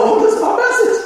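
high-pitched excited exclamation; delighted realization. Retold or imitated. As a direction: squeal it as a re-enacted revelation. Re-enacts delight at the discovery.

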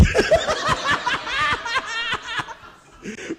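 high loud laughing fit; long loud laugh; big laugh. Real, not acted. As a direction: laugh hard at the memory. Laughing at own story.

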